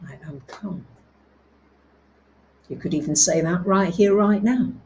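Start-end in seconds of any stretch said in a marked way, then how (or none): 0.1-0.9 soft, low repeated affirmation, fading away; a hushed lower mantra in traffic; quietly. Shown as a demonstration. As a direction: murmur it to yourself to stay calm. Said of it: "quietly say".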